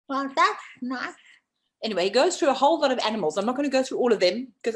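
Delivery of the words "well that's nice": high, slow frog reply; put-on frog, pitched up and slowed; wide-mouthed frog. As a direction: draw it out in a stretched-mouth frog voice.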